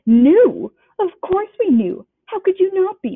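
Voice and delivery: high, loud, slow shrieking aunt; outraged aunt shrieking high and loud. Acted. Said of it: "shrieked Aunt Petunia".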